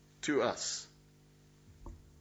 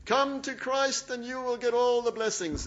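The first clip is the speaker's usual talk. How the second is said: much higher, louder selling voice; mock prosperity pitch; 11 semitones higher, 5 dB louder than usual. Preacher parodying churches selling blessings, much higher. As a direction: parody the easy promise. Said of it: "is what they say".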